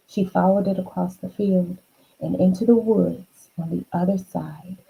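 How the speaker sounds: usual speaking voice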